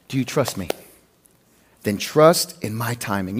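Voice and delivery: low, soft, measured question and answer; calm, gentle reply. Acted. Voicing God's gentle reply.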